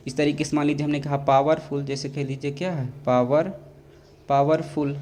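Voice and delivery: ordinary talk, nothing marked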